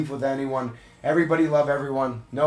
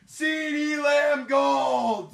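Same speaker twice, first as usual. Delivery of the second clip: excited, drawn-out card-pull call; hype announcer shout; 11 semitones higher, 3 dB louder, much slower than usual. Real, not acted. Streamer calling a big pull to viewers, hyped shout. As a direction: shout it out, stretching the name.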